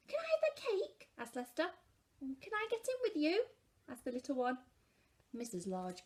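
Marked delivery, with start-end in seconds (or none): 0.1-1.1 put-on very high eager little boy; child's voice. A narrator's reading. Lester asking Mum for cake, very high child. 2.2-3.5 put-on high small child asking; child's voice. A narrator's reading. The little one asking Mum, high small child.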